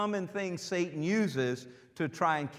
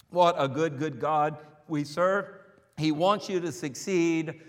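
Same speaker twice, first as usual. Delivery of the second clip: ordinary talk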